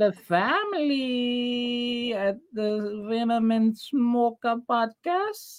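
high, very slow spooky voice; ominous announcer. Acted. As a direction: announce it slowly and eerily. Same put-on voice.

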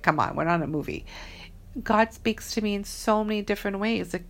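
normal talking voice

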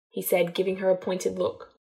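ordinary delivery

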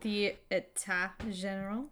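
État General said the French way; French pronunciation. Shown as a demonstration.

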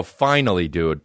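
ordinary delivery

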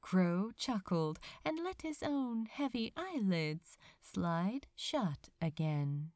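usual speaking voice

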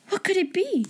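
high, hushed, scared; frightened whisper. A narrator's reading. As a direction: whisper it nervously. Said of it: "said Ermengarde".

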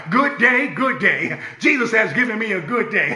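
preacher leading the congregation's chant; call and response. Shown as a demonstration. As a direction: sing it out for the church to repeat. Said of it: "say it every day".

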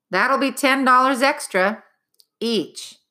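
high, prim clerk quoting a price; a precise little clerk, pleased with the fee; fussy nitpicking bureaucrat. Acted. Clerk quotes bath price.

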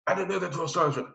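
put-on reply up to the sergeant as a young private; recruit answering sergeant. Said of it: "I'm not gonna make you".

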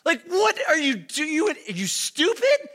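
mock yelling at yourself in disbelief; retold panicked outburst. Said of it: "I'm like".

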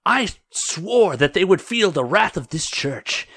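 indignant, hitting the key words hard; angrier voice. Acted. Angry churchwoman demanding action from her superior, heated and indignant. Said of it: "back to the angrier voice".

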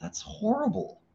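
a hammed-up inner hero recoiling; superhero side of brain. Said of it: "superhero be all things to everyone side of my brain's all".

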